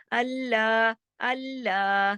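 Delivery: slow recited अल्लाह with long laa; madd elongation. Shown as a demonstration. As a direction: hold the second syllable of अल्लाह on a level tone. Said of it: "ऊपर मद भी है।". (अल्लाह के ला को खींचकर)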